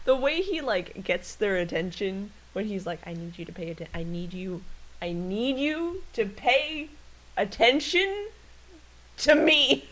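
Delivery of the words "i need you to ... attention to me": mock needy insistence, climbing in pitch; insistent cat. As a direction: build a pleading demand piece by piece.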